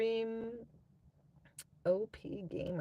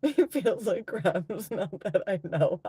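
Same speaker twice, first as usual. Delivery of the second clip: loud laughing through words; 3 semitones higher, 8 dB louder than usual. Real, not acted. Laughing at own joke.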